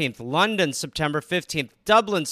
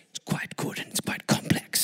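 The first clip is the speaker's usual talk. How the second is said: voiceless conspiratorial whisper; 6 dB quieter than usual. Acted. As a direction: lay out the plan in a whisper. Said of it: "the Whisperer".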